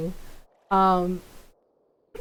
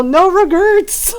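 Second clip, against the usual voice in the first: slow, higher, louder ad-line delivery; commercial voice; 7 semitones higher, 6 dB louder, slower than usual. Retold or imitated. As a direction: announce it like a TV slogan. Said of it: "The Snickers commercial".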